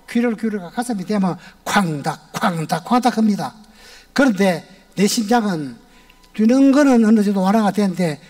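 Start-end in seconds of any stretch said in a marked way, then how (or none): none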